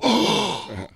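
big acted gasp of astonishment; mock shocked gasp. Indian listeners react to him, mock shocked gasp. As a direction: gasp as if amazed. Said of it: "They go".

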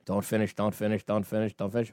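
low, soft chant to yourself; hushed, low self-coaching; acted inner voice. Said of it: "when I tell myself in my brain".

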